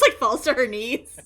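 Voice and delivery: normal talking voice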